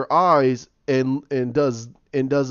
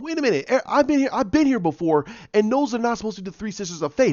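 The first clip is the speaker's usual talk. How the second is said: puzzled protest; 6 semitones higher, faster than usual. Retold or imitated. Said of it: "they're sitting there going".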